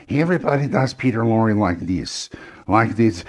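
exaggerated impression of a famous actor's voice; Peter Lorre caricature. Shown as a demonstration. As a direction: do the stock caricature everyone does. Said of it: "good for a caricature of an impression".

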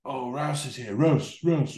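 high, mock crowd cheer; retold cheering. Festival crowd greeting Jason Rouse, imitated cheering. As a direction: imitate fans going crazy for their hero. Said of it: "and cheering him and going crazy".

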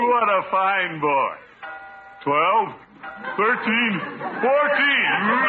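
high, loud and slow, savouring each word; gleeful. Acted. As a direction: beam with pride while counting the chimes. Praises boy, counts chimes gleefully.

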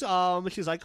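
ordinary talk, nothing marked

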